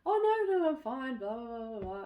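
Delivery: high-pitched protest trailing into blahs; insistent brush-off. Retold or imitated. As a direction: imitate someone waving off concern. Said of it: "they carried on".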